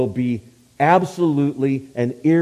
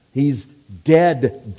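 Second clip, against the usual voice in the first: ordinary delivery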